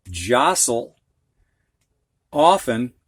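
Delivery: ordinary talk, nothing marked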